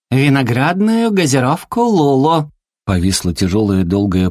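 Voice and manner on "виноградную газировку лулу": high, delighted sweet voice; name the treat in a high little voice; cutesy little creature. A narrator's reading. Зверёк называет свою награду. (милый высокий голосок зверька)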